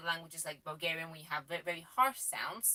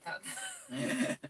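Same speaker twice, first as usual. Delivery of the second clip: breaking into a high, airy laugh mid-word. Real, not acted. Wife laughing at borrowing next door's Wi-Fi, giggling. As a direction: giggle through the okay.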